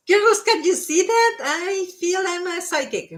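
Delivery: thrilled to be proven right; excited. Real, not acted. Reader shows viewers the card matches her, thrilled.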